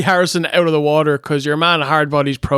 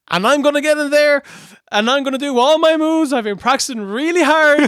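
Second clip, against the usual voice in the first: high, eager mock promo voice; excitable, high-pitched send-up; imitated wrestling promo; 10 semitones higher than usual. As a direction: mimic an earnest rookie's promo, pitched high. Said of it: "He's like".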